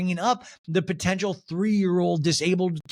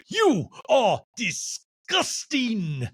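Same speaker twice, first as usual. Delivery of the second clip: hammed-up fury; 6 semitones higher, slower than usual. Recapping the fight, she plays Meredith snarling at Whitney. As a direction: spit it at her like a cartoon villain.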